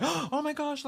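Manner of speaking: high, breathy shocked teacher; shocked gasp. Retold or imitated. Teacher shocked by the class chant, high alarmed gasp.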